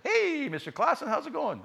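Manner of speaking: high, bright greeting; cheery principal. Retold or imitated. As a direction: sing out the hello.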